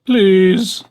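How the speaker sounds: high, rising, loud plea; wailing plea. A narrator's reading. Fox begging the dentist to let him in, wailing. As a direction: beg with a wail. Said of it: "the fox wailed".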